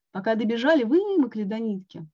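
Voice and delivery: ordinary delivery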